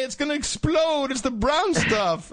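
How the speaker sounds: high panicky shrieking voice; mock panic. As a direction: mimic terrified officers. Mocking panicked police.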